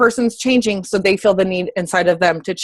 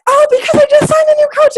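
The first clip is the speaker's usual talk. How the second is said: very high, loud excited squeal; squealing excitement; 6 dB louder than usual. Retold or imitated. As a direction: gush like a giddy schoolgirl. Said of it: "I'm like".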